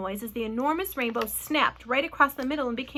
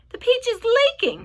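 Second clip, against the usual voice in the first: high, loud cry of alarm; old grasshopper's high-pitched yell; shouting in alarm; 13 semitones higher, 10 dB louder than usual. A narrator's reading. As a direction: shout it out to everyone. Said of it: "shouted the old green grasshopper".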